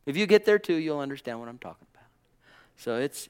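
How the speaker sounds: ordinary talk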